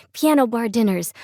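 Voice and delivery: usual speaking voice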